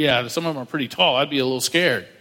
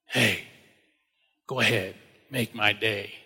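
lower, much softer cool tough guy; a cool, hushed, low Eastwood threat; Clint Eastwood impression; 7 semitones lower, 5 dB quieter than usual. David to Goliath, a low, cool Clint Eastwood threat. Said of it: "kinda like Clint Eastwood".

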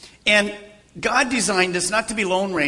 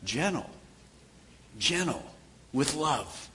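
gentle; 3 semitones lower, 6 dB quieter, slower than usual. Shown as a demonstration. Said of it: "I want it to be".

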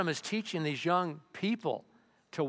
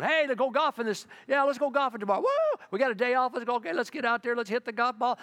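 loud, high, eager chatter about golfing; excited shout; 10 semitones higher, 5 dB louder than usual. Retold or imitated. Pastor playing his busy self, ignoring God, loudly excited. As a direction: rattle off weekend plans, all pumped up. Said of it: "and I'm going".

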